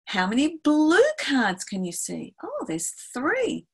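high, lilting classroom voice; bright-eyed questioning of young pupils; teacher to children. Acted. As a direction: pitch it up playfully for small children.

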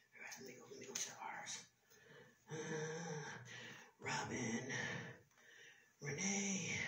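God quietly searching the book, then a whispered rejection; quiet searching murmur. Acted. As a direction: look through the book under your breath.

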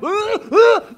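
loud high chuckle; high burst of laughter. Real, not acted. Preacher laughing at his own joke, loud and high.

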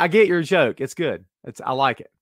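usual speaking voice

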